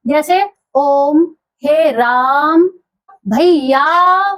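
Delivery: slow: ओम, हे राम and भैया with the last vowel long; stretched pluta. Shown as a demonstration. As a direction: call भैया as if to someone far off. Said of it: "जब हम किसी को खींच देते हैं लंबा".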